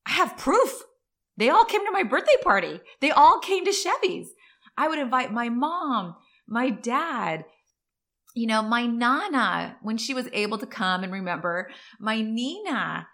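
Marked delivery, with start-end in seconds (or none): 0.0-8.5 excited. Real, not acted. Excited boast about loyal friends.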